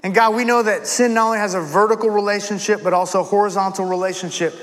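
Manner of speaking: measured, low-key pastor's prayer; earnest prayer. Real, not acted. Pastor praying to God for help confessing sins, earnest prayer. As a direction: pray aloud sincerely, with reflective pauses.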